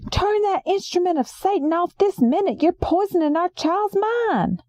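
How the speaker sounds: high, shouted command; screams out. Acted. Uncle Gerald ordering the TV off, high shouted scolding. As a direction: holler it like a preacher's warning. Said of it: "he screams out again".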